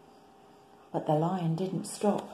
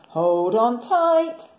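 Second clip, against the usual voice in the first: slow, loud booming call; roaring lion; 5 semitones higher, 5 dB louder, much slower than usual. Acted. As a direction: roar it out like a big lion. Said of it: "he roared".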